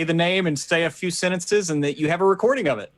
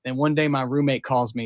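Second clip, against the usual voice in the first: ordinary talk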